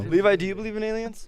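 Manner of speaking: high cooing pet voice; talking to a pet. Acted. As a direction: sweet-talk the dog. Talking to the dog.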